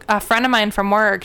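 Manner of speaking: ordinary talk, nothing marked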